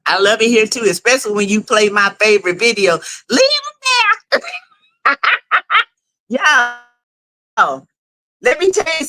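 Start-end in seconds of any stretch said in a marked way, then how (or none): 3.3-5.2 squeaky high playful order. Acted. Playful command on favorite video.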